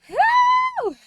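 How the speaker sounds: very high, loud mock cry for help; retold shout. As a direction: yell it high like a panicked call. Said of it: "ran up to him and went".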